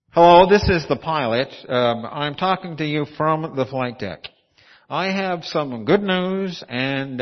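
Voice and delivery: loud airline pilot over the intercom; pilot announcement. Pretend. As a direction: announce it like a captain to the cabin. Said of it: "let's imagine you're on the plane, and this happens".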